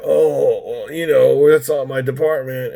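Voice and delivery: drawn-out mock agent voice; imitated retention lady. Retention agent brushing off a caller, mock drawl. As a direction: mimic a phone agent passing the buck. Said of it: "she did talk like this".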